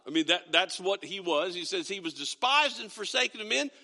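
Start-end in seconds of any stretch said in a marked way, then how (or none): 1.9-3.7 scripture reading. Acted. Pastor reads Isaiah aloud.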